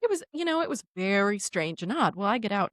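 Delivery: ordinary talk, nothing marked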